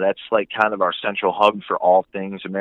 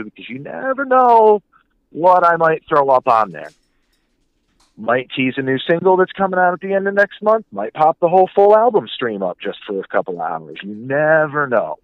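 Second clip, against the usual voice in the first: playful teasing; 7 semitones higher, 4 dB louder than usual. Real, not acted. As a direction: dangle a secret, voice swooping up high. Coyly teasing upcoming releases.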